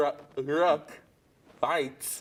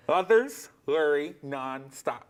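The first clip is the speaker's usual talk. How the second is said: ordinary delivery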